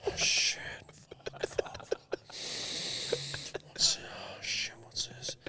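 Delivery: hushed, breathless whisper of alarm; scared whispered curses; panicked whisper. Retold or imitated. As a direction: whisper like you just woke up in a wet mess. Mate waking, whispering in panic.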